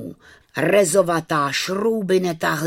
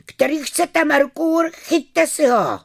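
high call, slowing at the end; shouting offer; 14 semitones higher, 5 dB louder than usual. A narrator's reading. Vojta offers his lost skate to boys, high shout. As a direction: holler it out to whoever grabs first. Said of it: "rozkřikl se Vojta".

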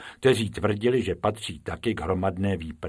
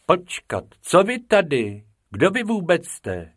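higher, startled demanding voice; startled teacher; 8 semitones higher, 4 dB louder than usual. Acted. Teacher suddenly noticing two new pupils, startled. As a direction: catch the strangers out.

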